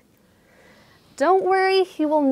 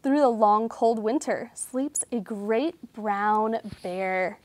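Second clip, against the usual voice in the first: storybook reading; 2 semitones higher, 4 dB louder than usual. A narrator's reading. Reading a sleepy bear story to children, storybook voice. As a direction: read it like a bedtime picture book. Reads picture book aloud.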